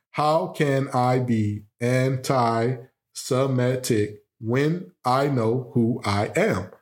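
slow, deliberate "How can I be anti-Semitic when I know who I am?"; syllable by syllable. Shown as a demonstration. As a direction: spell out every syllable to count them. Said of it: "let's count the syllables here".

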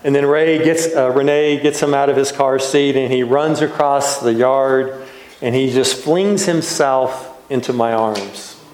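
ordinary delivery

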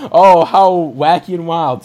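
loud, laughing mock delight; laughing mock cheer. Road trip movie exclaims loudly through laughter. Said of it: "It's like".

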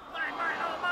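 put-on cooing over a new baby. Shown as a demonstration. Pastor acts out first seeing a newborn grandchild, gushing high and soft. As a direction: melt at the sight of a brand new baby. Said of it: "Brand new baby, you're seeing them for the first time".

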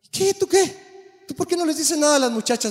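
high teasing coworker voice; mocking coworkers. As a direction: needle him like the guys at breakfast. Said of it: "me decían a mí".

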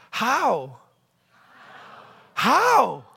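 incredulous questioning. Shown as a demonstration. Preacher leads congregation in the phrase, loudly incredulous. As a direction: call it out for the crowd to copy. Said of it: "say it exactly the way I say it".

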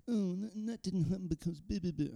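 high, soft whining voice mocking an excuse-maker; whiny excuse voice. Mocking people who blame bad luck, in a high whine. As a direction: whinge it like someone dodging blame.

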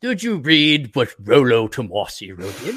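slow mock-British inquiry; unhurried British clubman. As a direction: ask it slowly in a fake English accent. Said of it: "none of us have British accents".

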